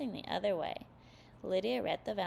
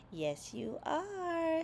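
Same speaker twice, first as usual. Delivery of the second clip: high, very slow insisting; Sally countering Linus, high and stretched out; insistent counter; 8 semitones higher, much slower than usual. A narrator's reading. Sally insists to Linus through the door, high and drawn out. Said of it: "Sally countered".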